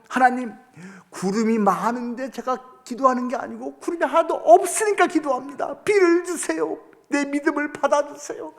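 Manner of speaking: high, earnest plea for water; mock-fervent prayer, pitched high; bold prayer. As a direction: pray boldly like a parched soldier.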